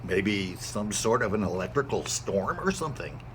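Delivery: low, unsure guess; low-voiced neighbor. A narrator's reading.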